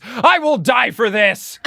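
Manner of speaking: high, drawn-out battle cry; hammed-up heroic scream; mock scream. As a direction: declare it slowly and high, overdramatic. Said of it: "then he screams".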